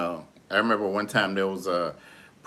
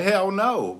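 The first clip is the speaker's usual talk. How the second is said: indignant refusal; 9 semitones higher than usual. Retold or imitated. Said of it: "And I was like".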